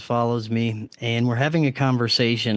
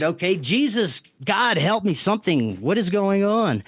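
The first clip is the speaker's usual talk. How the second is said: higher-pitched, pleading appeal to God; retold desperate plea; 6 semitones higher than usual. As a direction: retell a bewildered cry for help, not shouted.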